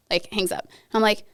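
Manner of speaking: ordinary talk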